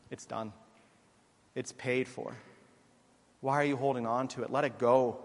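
very soft and gentle, each phrase set apart by pauses; hushed, as if lifting a burden off the listener; tender, soft reassurance. Acted. Preacher voicing God gently telling us the debt is paid, soft and tender.

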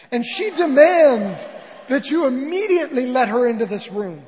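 loud, high, imperious demand; furious royal command, acted big; Queen Victoria. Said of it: "she banged on the door yelling".

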